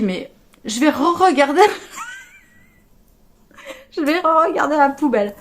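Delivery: exasperated shout. Retold or imitated. Exasperated search for lost earrings.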